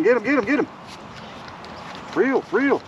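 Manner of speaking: ordinary delivery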